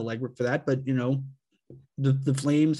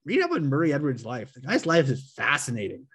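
ordinary talk, nothing marked

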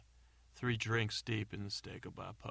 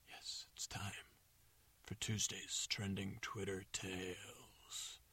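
softer, slow hushed announcement; breathy stage whisper of the title; dramatic whisper; 2 semitones lower, 8 dB quieter, slower than usual. Pretend. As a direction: whisper it like a secret. Hushed follow-up to the jingle.

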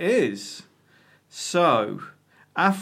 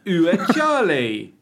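hammed-up French accent; 4 semitones higher, 5 dB louder, slower than usual. Corrects French title, hammed.

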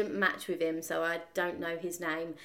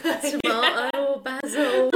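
mock panic; 5 semitones higher, 8 dB louder than usual. Imitating Jade panicking in kitchen.

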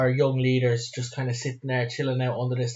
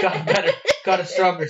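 laughing; 6 semitones higher, 5 dB louder than usual. Real, not acted. Laughing at his own joke.

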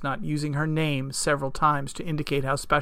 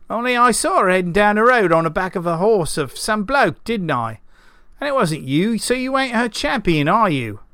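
put-on higher, challenging old farmer voice; toothless old farmer; 6 semitones higher, 5 dB louder than usual. A narrator's reading. Old farmer challenging the self-styled champion, sputtering. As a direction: argue like a sputtering old rustic. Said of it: "the old farmer sputtered through missing teeth".

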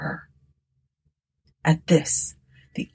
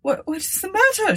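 put-on high, anxious old woman; alarmed cry. A narrator's reading. Old woman entering the room, crying out. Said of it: "she cried".

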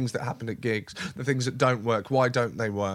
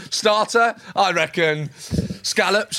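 mock waiter pitch; 6 semitones higher, 6 dB louder, slower than usual. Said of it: "You can go".

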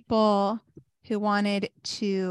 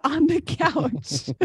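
slow, higher words through a laugh; laughing suggestion; 6 semitones higher, slower than usual. Retold or imitated. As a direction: crack up while retelling the offer. Laughing at her own absurd offer.

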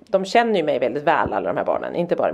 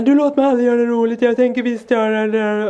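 put-on protesting child; 5 semitones higher, 4 dB louder than usual. Son arguing with mum about having fun, protesting child.

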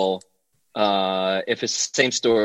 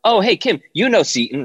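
lightly imitated breezy introduction, pitched up; Chrissy Teigen; 9 semitones higher than usual. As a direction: casually introduce a friend in a higher voice. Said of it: "Chrissy Teigen turns and goes".

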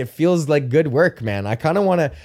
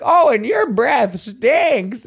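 put-on slow, much higher creaky old man sneer; old man taunt; 10 semitones higher, 3 dB louder, much slower than usual. Old bully taunting another old man, slow creaky sneer. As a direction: drag it out like a wheezy old bully. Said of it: "He's like".

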